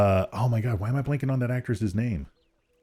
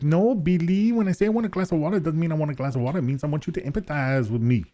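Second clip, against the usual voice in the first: put-on Rosie Perez New York accent; 7 semitones higher, faster than usual.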